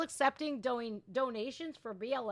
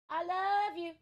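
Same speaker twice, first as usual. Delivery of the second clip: playful love you; 9 semitones higher than usual. Real, not acted. Saying goodbye to his child.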